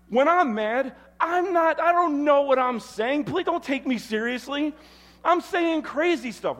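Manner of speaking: heated, high, loud outburst; getting mad. Real, not acted. Preacher reliving an argument with his wife, getting mad. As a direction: get worked up, pitch climbing well up. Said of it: "I'm just getting mad right now".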